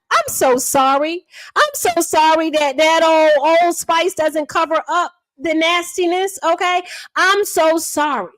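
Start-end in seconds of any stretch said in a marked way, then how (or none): none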